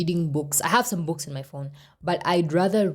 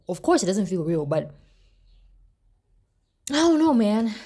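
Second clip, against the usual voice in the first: ordinary talk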